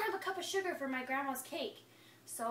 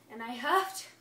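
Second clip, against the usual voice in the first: huffing up to a sneeze, rising louder; building sneeze; 3 semitones higher, 5 dB louder than usual. Acted. Wolf winds up a sneeze at the pig's house, building. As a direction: breathe in harder and harder before the sneeze.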